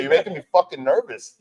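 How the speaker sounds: high, loud mock nerves; imitated nervous complaint. Johnny to the beer-pounding band, mock nervous.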